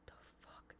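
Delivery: barely-there whispered disbelief; baffled whisper. Retold or imitated. Streamer reliving hearing a baby cry, baffled whisper. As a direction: whisper it very softly, puzzled. Retold baffled reaction to crying.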